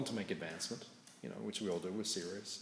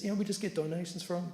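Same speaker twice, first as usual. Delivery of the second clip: higher, easy donation ask; light friendly pitch; 9 semitones higher, 4 dB louder than usual. Retold or imitated. Monk Navina asking the driver for donations, higher and easy. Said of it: "he says".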